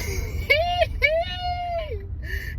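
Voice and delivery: long, very high cackle; real helpless squeal of laughter. Real, not acted. Host cracking up at him walking off, squealing laugh.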